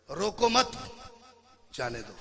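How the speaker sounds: रोको मत together, pause, जाने दो low; pause after मत. Shown as a demonstration. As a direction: let them go: रोको मत, break, जाने दो falling. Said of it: "लहजा बदलने से मतलब बिल्कुल". ("रोको मत" के बाद ठहराव, फिर "जाने दो")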